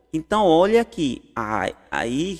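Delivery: ordinary delivery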